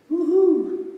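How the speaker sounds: high excited whoop at the view; a gleeful cheer at the landscape. Pretend. Preacher to congregation, gleeful whoop.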